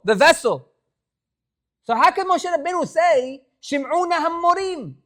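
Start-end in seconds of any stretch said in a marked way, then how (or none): 1.8-4.9 emphatic re-ask. Shown as a demonstration. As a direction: drive the question at the class. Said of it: "So you hear the question?".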